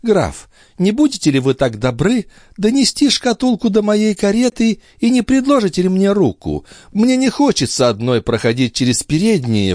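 high, smiling feminine voice; charming countess. A narrator's reading. Countess asking the count to escort her, high and charming. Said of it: "с очаровательной улыбкой попросила".

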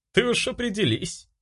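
high, scoffing advice; wry inner nag; snorting inner voice. A narrator's reading. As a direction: scoff at yourself, pitched high. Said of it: "хмыкнув, посоветовал всё тот же голос".

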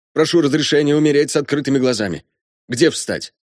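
high-pitched, fervent officer; father eager to follow his daughter; resolute condemned colonel. Acted. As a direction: request the sentence formally but with rising fervour.

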